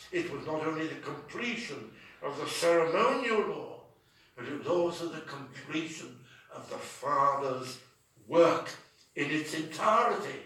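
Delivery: ordinary talk, nothing marked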